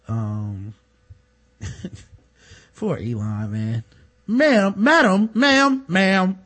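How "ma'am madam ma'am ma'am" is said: loud, raised, insistent calls of ma'am; mock calling out. Host acts out addressing a woman, loud insistent calling. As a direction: call over her, higher and louder.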